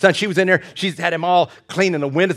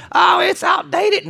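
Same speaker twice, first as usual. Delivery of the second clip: high, drawn-out mocking whine; exaggerated high-voiced scoffing; mock dismissive complaint; 13 semitones higher, slower than usual. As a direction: mimic a silly objector, pitched way up. Mimics people dismissing the Old Testament.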